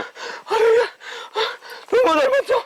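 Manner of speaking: high, anxious warning; fearful pleading. Acted. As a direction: beg him to stay back. Begs friend not to go. (डरी हुई ऊँची आवाज़ में मिन्नत)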